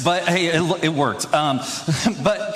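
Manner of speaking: ordinary delivery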